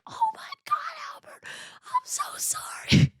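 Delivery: high, breathy whispered crying apology. Retold or imitated. Apologizing to her dog Albert, tearful whisper. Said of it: "and I'm like".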